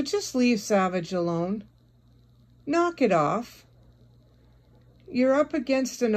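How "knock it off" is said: ordinary delivery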